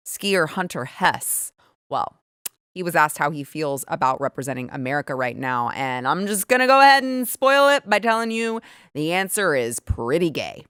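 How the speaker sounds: ordinary talk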